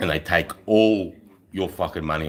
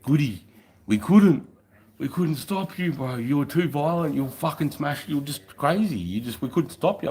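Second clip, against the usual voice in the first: high, put-on mate's voice, pitched up; 9 semitones higher than usual. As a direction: voice the mate, higher and insistent. Mate's voice in retold story.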